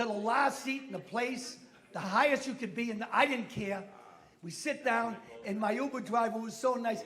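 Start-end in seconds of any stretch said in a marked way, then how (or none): none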